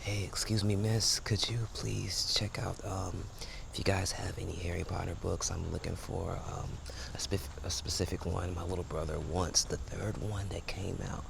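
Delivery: hushed, soft library voice; library whisper. Acted. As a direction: keep it down like in a library. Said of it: "that's my library voice".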